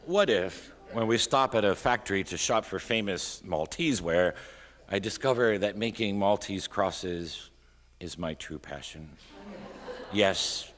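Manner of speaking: exuberant poem reading. A narrator's reading. Poet dreaming up a Maltese life, exuberant poem reading.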